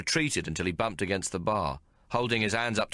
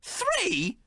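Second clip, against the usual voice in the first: Three? as an outraged shriek; outraged scream. A narrator's reading. Maggie hearing the tailor's price, screaming. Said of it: "Maggie screamed".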